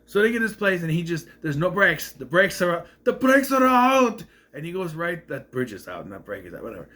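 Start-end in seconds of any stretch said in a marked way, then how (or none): 3.0-4.2 high, drawn-out shout imitating the character; panicked cry. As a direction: cry out the alarm in mock panic, slowly. Acting out panicked driver's quote.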